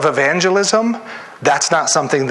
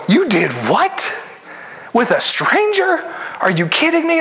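incredulous; 10 semitones higher than usual. Retold or imitated. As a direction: gasp it out like you can't believe it. Regular people hearing evangelist's story.

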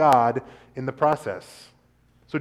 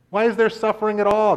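higher, anguished question to God; pleading Job; 8 semitones higher, 4 dB louder than usual. Acted. Job demanding answers from God, higher and anguished. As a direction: ask why, hurt and searching.

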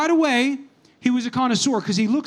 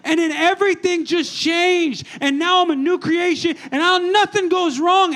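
mock testimony; 6 semitones higher than usual. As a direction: ham up a born-again testimony. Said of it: "they're like, Man".

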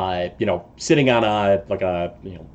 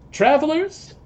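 high call across the dark to strangers; shouting out. Acted. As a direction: call out to the distant camp. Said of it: "shout towards your camp".

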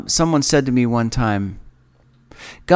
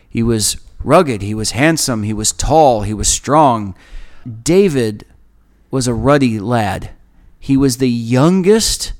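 ordinary delivery

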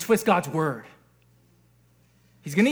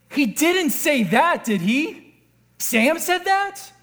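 slow, high-pitched mock disbelief; outraged disbelief; 4 semitones higher, slower than usual. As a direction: draw it out as an incredulous question. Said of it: "expressing outrageous disbelief".

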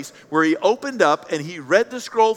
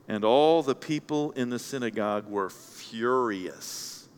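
slow, low and softer; hushed reading; 8 semitones lower, 6 dB quieter, slower than usual. A narrator's reading. Preacher reveals the crowd's turn, hushed and slow. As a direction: let the verse land slowly and quietly.

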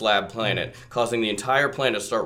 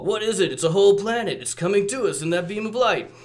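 higher alarmed voice; 8 semitones higher than usual. Retold or imitated. Decepticons quote read.

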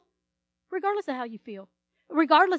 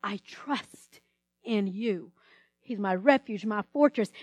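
hushed, lower, intimate confession; soft prayer; 6 semitones lower, 7 dB quieter, slower than usual. Pretend. Believer quietly telling God of trust, hushed. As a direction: murmur it like a private prayer. Softly voicing a personal prayer.

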